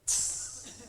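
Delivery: voiceless "tss" of annoyance; sharp huffy hiss. Acted. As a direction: hiss like an angry wife pulling away. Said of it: "like that".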